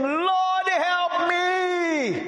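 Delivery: rising, drawn-out desperate plea; a mother's high pleading cry for help. Acted. Preacher acts out pleading woman.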